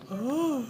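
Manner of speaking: high pained "oh"; lone moan. Acted. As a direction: groan high into a silent hall. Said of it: "The only noise that's echoing".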